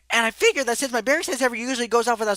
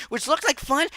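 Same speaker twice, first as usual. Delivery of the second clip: ordinary talk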